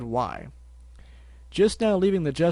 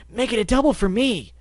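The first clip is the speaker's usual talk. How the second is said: high, loud eager add-on; 9 semitones higher, 6 dB louder than usual. Acted. Barry adding his order, eager and loud. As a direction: chime in eagerly.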